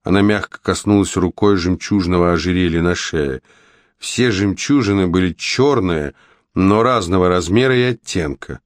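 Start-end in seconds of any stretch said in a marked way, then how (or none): none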